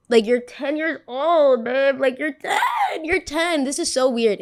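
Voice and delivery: outraged disbelief. Real, not acted. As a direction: cry out that she's only ten. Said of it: "It even feels weird".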